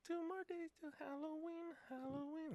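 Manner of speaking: high, soft creepy sing-song. Pretend. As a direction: creep them out in a high eerie voice. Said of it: "that first voice you did".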